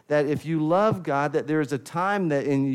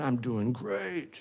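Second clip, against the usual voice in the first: slow, softer put-on "I'm doing great", a hint of pretending; mock the churchgoer faking he's fine; fake fine answer; 5 dB quieter, slower than usual. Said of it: "The word literally means an actor".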